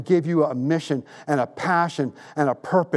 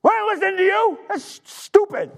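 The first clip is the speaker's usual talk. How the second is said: mock the women like scornful disciples; scoffing disciples. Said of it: "the disciples were like".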